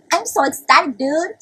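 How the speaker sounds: high, loud burst of mock excitement; squeal-pitched, over-the-top delight; hammed-up ecstasy. Repeats the ecstatic claim, higher.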